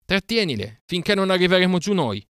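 high, loud command over the herd; shouting orders. Acted. Mowgli to Grey Brother while herding, high loud shout. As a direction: bark the order over the noise.

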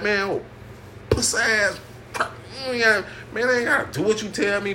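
high-pitched mock defiance; defiant inner retort. Acts out his silent defiant retort.

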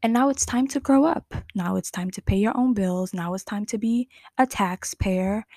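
ordinary talk, nothing marked

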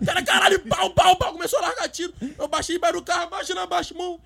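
high, loud, breathless storytelling; reliving the shootout; excited retelling. Retold or imitated. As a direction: rush through the chaos. Chaotic shooting scene retold.

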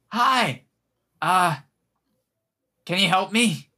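high little elf voice; tiny squeaky voice. Acted. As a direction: pitch it up small and squeaky. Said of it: "tiny, squeaky voice".

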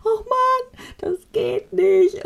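high, slow whiny wail; mock crying whine. Retelling her own meltdown, high drawn-out whine. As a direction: whimper it out like a kid about to cry. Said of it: "ich fang einfach grundsätzlich an zu heulen".

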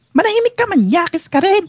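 very high squeaky comeback; mock scolding voice. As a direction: act out an indignant retort way up high. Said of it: "Ganyan yung boses". (kunwaring paninita sa napakatinis na boses)

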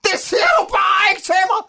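high, indignant shouting; furious outburst. Acted. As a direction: shout in wounded, melodramatic outrage. Acted furious outburst at interviewer. (rasende, forurettet råben)

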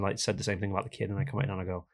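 ordinary delivery

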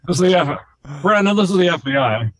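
loud, high joking announcement; mock FBI agent. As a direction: announce yourself as a pretend federal agent, loudly. Mock official after recording joke.

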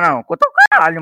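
sharp high protest, fast and loud; indignant outburst. Real, not acted. Friend protests at the host, high angry burst. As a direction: blurt it out in annoyed disbelief.

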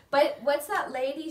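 ordinary delivery

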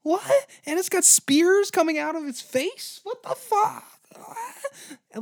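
very high, frantic yelps; panicked soldier. Retold or imitated. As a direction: squeal in rising panic. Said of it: "And you're like".

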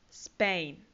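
"Spain" as one syllable, opening on s; English pronunciation. Shown as a demonstration. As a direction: model "Spain" for learners, low and falling. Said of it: "España se dice". ("Spain" pronunciado en inglés, tono descendente)

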